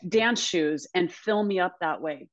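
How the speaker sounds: usual speaking voice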